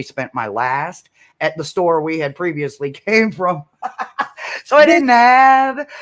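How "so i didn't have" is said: laughing. Real, not acted.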